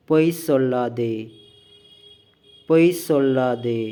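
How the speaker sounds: slow, deliberate drill delivery, each word set apart; measured, emphatic classroom read-out; language-lesson model reading. Shown as a demonstration. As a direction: read out each phrase firmly for learners to copy. Reads out model sentences for learners.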